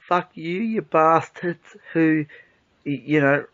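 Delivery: normal talking voice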